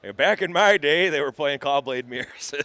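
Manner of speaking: put-on creaky old-timer reminiscing; old man voice. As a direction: talk like a grumpy grandpa. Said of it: "you did your old man voice for that".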